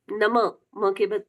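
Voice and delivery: usual speaking voice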